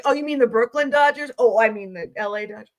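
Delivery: lightly voiced, playfully feigned mix-up; mock confusion. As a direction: act out a sly pretend mistake. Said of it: "I was like".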